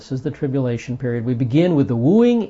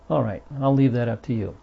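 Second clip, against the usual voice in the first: ordinary delivery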